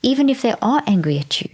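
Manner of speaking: ordinary delivery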